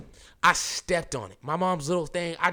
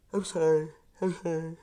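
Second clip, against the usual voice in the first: apology through a yawn; 3 semitones higher than usual. Real, not acted. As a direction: say it mid-yawn. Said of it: "can't stop yawning".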